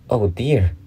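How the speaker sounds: mildly sorrowful exclamation. A narrator's reading. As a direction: lament it with lifted pitch. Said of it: "in a sorrowful tone".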